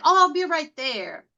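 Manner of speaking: high, carefree brush-off; a light imitation of the client, airily unbothered; breezy client. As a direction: stylist mimicking the client who wandered off mid-haircut, high and breezy. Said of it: "She's like".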